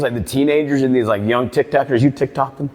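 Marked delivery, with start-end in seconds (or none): none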